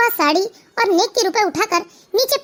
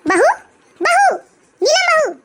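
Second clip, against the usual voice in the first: raised, higher-pitched summons; calling out; 9 semitones higher, 3 dB louder than usual. Acted. Mother-in-law summons her daughter-in-law, calling out high. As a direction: call her from across the house.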